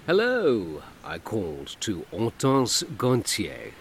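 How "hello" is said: forced cheer. A narrator's reading. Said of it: "as cheerfully and casually as".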